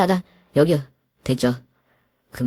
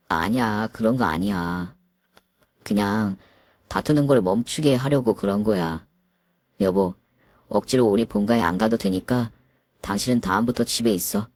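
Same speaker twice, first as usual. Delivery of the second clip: timid husband; 7 semitones lower than usual. Acted. Husband defends himself to his wife, low and timid.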